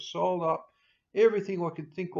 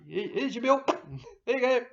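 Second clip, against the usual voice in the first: lightly raised, breezy mock handover; mock adviser handing a bill; 7 semitones higher, 5 dB louder, faster than usual. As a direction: toss the bill over with a smug grin. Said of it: "those boys, they, oh, bloody".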